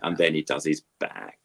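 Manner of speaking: ordinary talk, nothing marked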